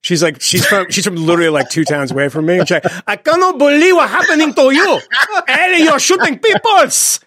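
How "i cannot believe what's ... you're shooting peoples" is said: put-on high, slow, laughing Mexican accent; scolding in a hammed-up Mexican accent. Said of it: "does a hilarious Mexican accent".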